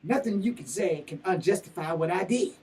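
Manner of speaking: usual speaking voice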